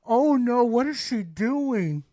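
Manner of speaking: horrified reaction, slowing on the question; dismayed. Retold or imitated. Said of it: "I just sat there like".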